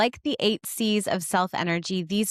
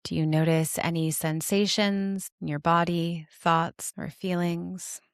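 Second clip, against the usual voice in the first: guided meditation; 3 semitones lower than usual. Acted. Host inviting listeners to notice their parts, guided meditation. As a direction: guide them inward, unhurried and calm, settling lower than chatty talk.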